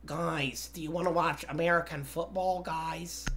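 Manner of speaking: softly spoken imitation of an American accent; put-on American accent. Shown as a demonstration. British-claiming gamer obliging teammates, put-on American accent. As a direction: do an American accent, low-key and quiet. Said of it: "You want me to do an American accent right now?".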